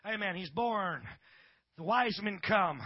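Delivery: ordinary delivery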